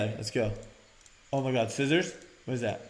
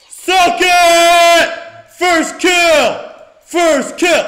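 very loud, high victory yell; gloating shout; 16 dB louder, much slower than usual. Real, not acted. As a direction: scream your triumph at the enemy. Gloating over first kill.

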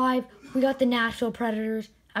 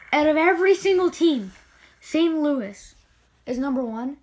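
higher and louder, indignant; can't believe this team won; loud incredulous outburst; outraged disbelief; 4 semitones higher, 5 dB louder than usual. Real, not acted.

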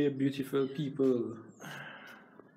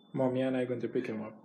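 ordinary delivery

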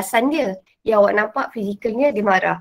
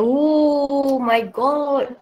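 mock dismay; 3 semitones higher, much slower than usual. Real, not acted. Teacher reacts to question.

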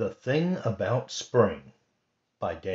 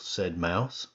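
plain reading voice

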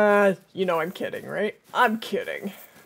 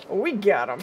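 ordinary talk, nothing marked

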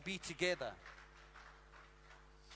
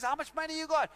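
eager hustler; 6 semitones higher, 6 dB louder, faster than usual. Retold or imitated. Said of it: "He says".

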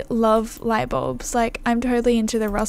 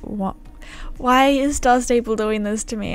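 normal talking voice

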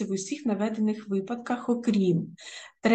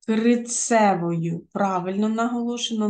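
usual speaking voice

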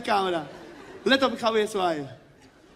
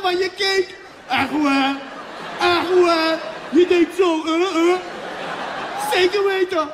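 high, loud sideline yelling with laughter; yelling coach; 6 semitones higher, 5 dB louder than usual. Retold or imitated. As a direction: coach screaming at players and referee, half laughing. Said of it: "Let op".

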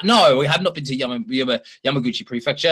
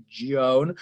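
ordinary delivery

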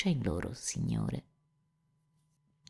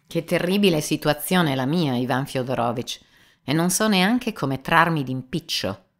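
firm and offhand; 5 semitones higher, 6 dB louder than usual. Acted. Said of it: "con tono fermo e disinvolto".

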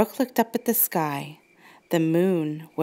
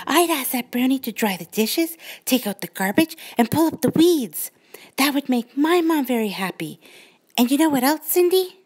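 put-on high, building little girl excitement; a child getting carried away; excited girl voice; 6 semitones higher than usual. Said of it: "said Brooke".

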